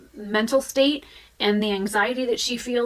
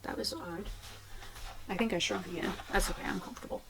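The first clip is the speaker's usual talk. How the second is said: much softer, half-whispered aside; quiet aside; 3 semitones lower, 14 dB quieter, faster than usual. Real, not acted. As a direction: murmur it to yourself. Drops voice after odd moment.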